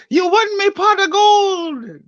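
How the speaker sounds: very high, slow Irish leprechaun squeak. Pretend. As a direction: squeak it out slowly like a tiny leprechaun. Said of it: "a leprechaun sticks his head out".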